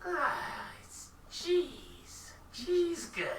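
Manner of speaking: high, slow, prim merchant; high shopkeeper voice. Acted. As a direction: answer sweetly in a high falsetto.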